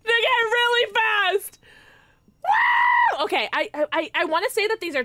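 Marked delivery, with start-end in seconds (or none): 0.0-1.6 high, loud, stretched yell; thrilled drawn-out cry; excited shout. Real, not acted. Thrilled reading the speed boost. 2.4-3.2 very high, loud whoop; shrieked cheer of excitement; excited whoop. Real, not acted. Cheering the speed boost.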